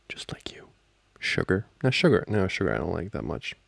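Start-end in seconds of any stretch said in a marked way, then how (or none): 0.1-0.7 very soft, nearly voiceless; whispered compliment. Acted. Sweet-talking the partner being addressed, whispered compliment. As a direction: drop to an intimate whisper.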